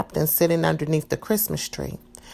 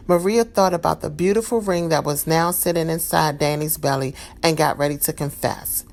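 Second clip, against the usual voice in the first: plain reading voice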